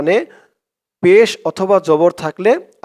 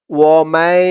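slow, drawn-out chanted recitation; Quran recitation; 4 dB louder, much slower than usual. Shown as a demonstration. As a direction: recite it slowly, stretching each vowel. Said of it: "recites the example".

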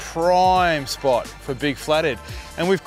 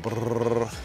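soft, low rolled brrr; imitated rod-tip buzz; 4 semitones lower, 6 dB quieter than usual. Shown as a demonstration. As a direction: trill the lips like a quivering rod tip.

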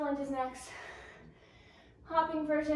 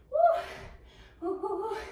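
high, loud whoops; cheering woo; 9 semitones higher, 6 dB louder than usual. Real, not acted. Coach cheering the class in the last seconds, high loud whoops. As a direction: cheer them through the burn.

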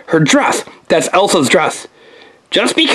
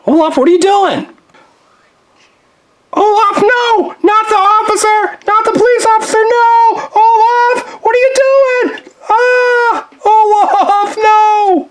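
horrified shouting; 12 semitones higher, slower than usual. Acted. Jack shouting at Olaf as he slimes the police officer. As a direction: scream it out in horror.

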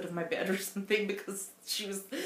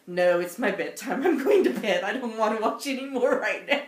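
mock refusal; 3 semitones higher, 9 dB louder than usual. Quoting sister refusing, bedtime.

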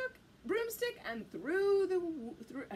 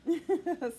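short cackling laugh; quick witchy cackle; witch's cackle; 4 dB louder than usual. Acted. Witch pleased to take the frog along, cackling.

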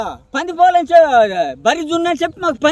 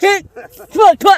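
higher-pitched spat-out disgust; disgusted spitting; 5 semitones higher than usual. Real, not acted. Man told he ate pig-milk cheese, disgusted spitting. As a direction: spit out each syllable in revulsion.

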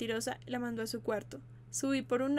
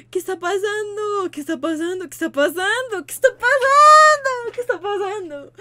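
loud, higher repeated shouts; mock panicked yelling; 8 semitones higher, 16 dB louder than usual. Streamer yelling the same question over and over, loud mock panic. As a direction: keep yelling in fake panic.

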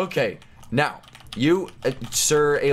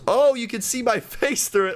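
normal talking voice

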